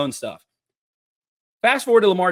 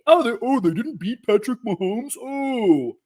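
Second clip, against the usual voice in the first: put-on voice of complaining fans; mocking whine; 4 semitones higher than usual. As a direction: mimic a disappointed fan in mockery.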